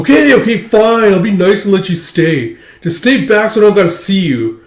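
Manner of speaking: put-on high, loud grumpy kid; grudging bratty boy. Damien grudgingly letting Anya stay, high grumpy voice. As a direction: give in grudgingly like a sulky rich boy.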